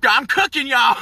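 high, loud and laughing; giddy boast. Real, not acted. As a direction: crow it up high, breaking into a laugh. Giddy boast about being back.

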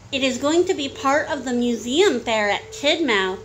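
usual speaking voice

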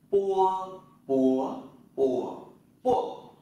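"pua" with unaspirated p, loud and high, four tones; Chinese pinyin drill. Shown as a demonstration. Teacher drilling students through "pua". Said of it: "Chúng ta tập lại".

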